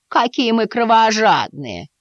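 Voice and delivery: высоко, нараспев, с издёвкой; протянуть медленно, поддразнивая; насмешливо хмыкая. A narrator's reading. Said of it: "хмыкнула Дульсимот". (мама дразнит грозного котёнка)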